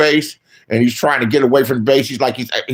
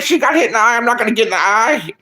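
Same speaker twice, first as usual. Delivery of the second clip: put-on childish reasoning; 7 semitones higher, faster than usual. Child's inner reasoning retold.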